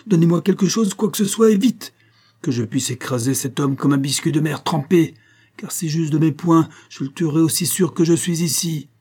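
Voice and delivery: high, urgent threats, loud at first then easing; furious cry. A narrator's reading. Captain threatening to crush the idiot, crying out angrily. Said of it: "cria le capitaine Prodder".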